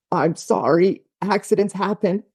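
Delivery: put-on high, small child's voice, teary apology; little kid apologizing. Child crying after spilling plate.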